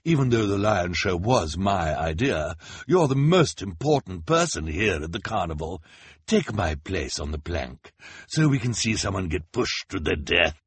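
villainous mock generosity through a smile; nasty smile. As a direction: flatter the victim with malice underneath. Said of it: "said with a nasty smile".

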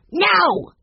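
high, loud shout at the dog; top-of-voice shout. A narrator's reading. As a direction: yell it at the top of your voice. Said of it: "shouted at the top of his voice".

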